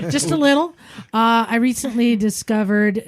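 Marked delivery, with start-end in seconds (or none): 0.1-0.7 higher, laughing echo; laughing aside. Real, not acted. Host teases the listener about dropping the bike, laughing. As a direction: chuckle the words out.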